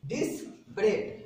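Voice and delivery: डिस्परेज whispered slowly, ending in ट; whispered pronunciation. Shown as a demonstration. Teacher showing the changed word in a slow whisper. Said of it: "जी को टी कर दिए".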